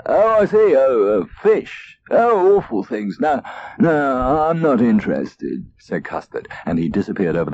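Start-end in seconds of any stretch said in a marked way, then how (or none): 0.1-5.7 fake-casual cat brushing it off; feigned disinterest. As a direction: pretend you hate fish.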